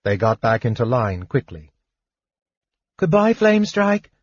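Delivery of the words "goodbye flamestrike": put-on high-pitched childlike goodbye; high, small child's voice; wistful children calling out. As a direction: call a wistful farewell as a child. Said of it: "several of the children called out, wistfully".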